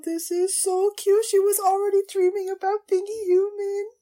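high, loud, excited gush; overcome gushing. Real, not acted. Viewer reacting to the character dreaming of being human, gushing.

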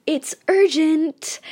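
cooing. A narrator's reading. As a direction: coo the urgency sweetly. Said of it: "I cooed again".

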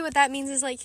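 ordinary talk, nothing marked

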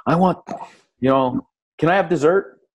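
put-on high-pitched pleading child's question; kid asking. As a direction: play a kid angling for a treat. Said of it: "if my kids wanna do something".

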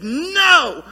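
sudden loud, high shouted no. Retold or imitated. Angry grandmother shouting at pastor: loud shouted no. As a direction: bark a flat refusal at him. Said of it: "somebody just, just shouts".

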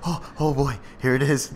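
softly voiced imitation of nerves; small, hushed uh-oh under the breath; nervous. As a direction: play mildly nervous, keeping it quiet. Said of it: "He was all nervous".

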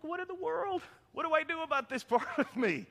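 high, squeaky comic exasperation; mock bewilderment. Man whose hidden self keeps escaping, mock bewilderment. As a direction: pitch it way up, helplessly baffled.

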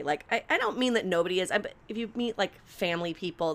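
ordinary talk

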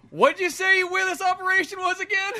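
squeaking through laughter, high and loud; laughing high question. Real, not acted. Patient teasing the Doc, laughing high and loud. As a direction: fight off giggles asking the doctor.

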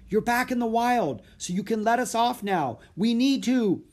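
high, loud, insistent reasoning; yelled plea. A narrator's reading. Boy asks elephant to let them off, yelled plea. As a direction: keep calling up to the animal. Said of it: "yelled Jack".

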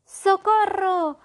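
slow, high, loud cry of socorro; desperate wizard screaming for help; howling for help. Acted. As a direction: howl it out while drowning. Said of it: "aullaba el mago".

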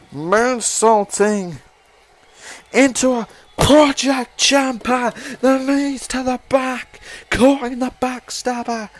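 loud, building excited call. Real, not acted. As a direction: rise to a roar as it hits. Climactic move called live.

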